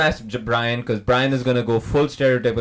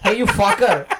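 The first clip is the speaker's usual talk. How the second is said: put-on Indian accent; 6 semitones higher, 3 dB louder than usual. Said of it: "Indian Mister Freeze".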